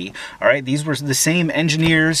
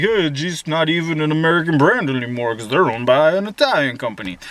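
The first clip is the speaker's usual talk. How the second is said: a light mock of a smug know-it-all voice. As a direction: parrot the annoying claim, lightly mocking it. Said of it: "and they're like".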